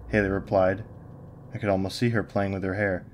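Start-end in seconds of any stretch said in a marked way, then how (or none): none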